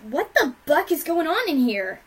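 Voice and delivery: high, loud outburst; sudden yell. A narrator's reading. Rainbow Dash bursting in on the beating, yelling. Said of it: "a voice suddenly yelled".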